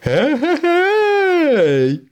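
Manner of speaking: very high, long drawn-out whoop; joyful whoop. Acted. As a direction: whoop as you spin round. Boasting racer whoops while spinning. (протяжний радісний вигук)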